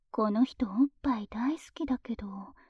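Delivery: whispering. Acted. Whispered aside.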